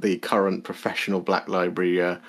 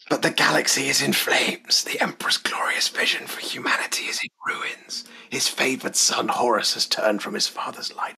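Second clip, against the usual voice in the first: soft unvoiced whisper; 2 semitones higher, 4 dB quieter than usual. Shown as a demonstration. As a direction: breathe it like a bedtime secret. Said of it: "I'm just whispering her".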